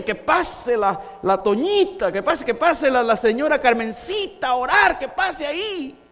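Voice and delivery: clamouring for a favourite, mock eager; eager congregation request. Congregation begging that Carmencita come up to pray, eager clamour. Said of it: "algunos pedían".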